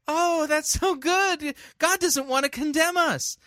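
mock a relieved churchgoer, sweetly; naive churchgoer. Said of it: "people go".

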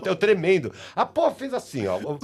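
usual speaking voice

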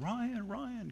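high, quiet mock call Ryan, Ryan; mock accent calling lions. Retelling a movie man calling the dog, high mock accent. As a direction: pitch up to a thin call of Ryan, Ryan. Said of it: "that guy comes out".

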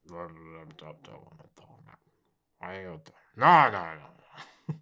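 low sleepy rumble, lightly voiced; a drowsy dragon grumbling no; rumbling dragon voice. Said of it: "you hear a rumbling voice go".

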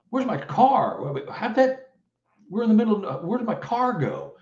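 high, startled cry of confusion; panicked disbelief. Retold or imitated. Narrator to listeners, reliving the missing car: high panicked disbelief. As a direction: voice jumps up, baffled and alarmed.

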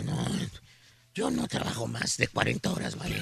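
low hoarse hungover rasp; raspy voice. Retold or imitated. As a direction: rasp it like a reporter with a cold. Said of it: "Con voz aguardentosa dice".

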